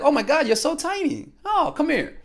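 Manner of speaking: fond, sing-song high coo; cooing at a woman. Retold or imitated. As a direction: coo over her like she's adorable. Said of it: "something that I tell women".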